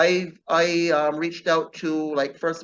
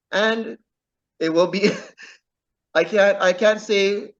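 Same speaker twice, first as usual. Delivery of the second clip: real laugh breaking into the words, louder; 3 semitones higher, 4 dB louder than usual. Real, not acted. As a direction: laugh through a coy refusal. Laughing while teasing next book.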